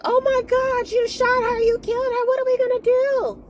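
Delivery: high, frantic squeal; high-pitched freaked-out wail; panicked Caroline. Retold or imitated. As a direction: shriek it out in a panic. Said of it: "Caroline is freaking out".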